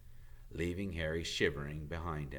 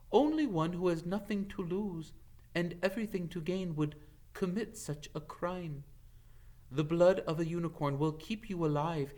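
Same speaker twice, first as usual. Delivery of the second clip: solemn centaur warning; 10 semitones higher than usual. A narrator's reading. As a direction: warn of a terrible price. Firenze warns gravely.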